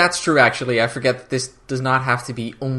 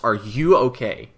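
slow, deliberate "you" in the middle; stress on YOU; 3 semitones lower, slower than usual. Retold or imitated. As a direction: ask it slowly, leaning on "you". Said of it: "He doesn't care about anything else".

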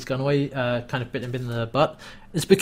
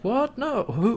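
high feigned surprise; 9 semitones higher, slower than usual. Retold or imitated. Varel feigning confusion.

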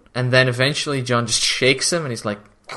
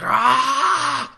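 long, high "aah" yell, rising; high mock holler; imitated shout; 12 semitones higher than usual. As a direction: mimic a drawn-out scream of alarm. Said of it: "he's just shouting".

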